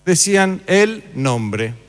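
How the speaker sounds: "el nombre" drawn out slowly, rising on "el"; slow emphasis. Shown as a demonstration. To the class, revealing the term, slow and weighty.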